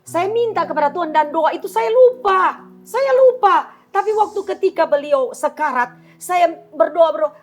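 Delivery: high, loud testimony at full force; impassioned preaching. Real, not acted. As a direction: proclaim it fervently to the congregation.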